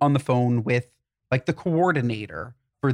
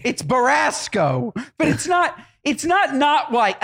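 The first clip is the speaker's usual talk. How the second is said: exasperated insisting; 9 semitones higher, slower than usual. Real, not acted. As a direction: plead your point, exasperated. Exasperated insisting on car color.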